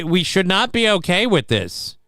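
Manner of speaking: usual speaking voice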